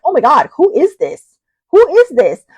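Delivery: loud, high, astonished "who is this?"; startled surprise. Retold or imitated. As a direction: yelp it out as if a stranger rang. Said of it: "I was like".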